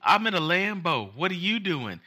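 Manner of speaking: put-on high, bragging little-girl voice; mock bratty kid. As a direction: parody a spoiled kid showing off. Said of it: "she's like".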